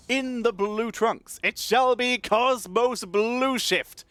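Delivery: hammed-up prize fight announcer; ring announcer. Boxing ring announcer intro.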